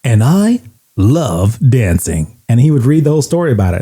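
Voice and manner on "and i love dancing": radio host reading out a letter, slowing down; deliberate countdown-host impression with stretched words; Casey Kasem. Host reading a fourth grader's letter, deliberate radio impression. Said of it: "He would read it in his voice".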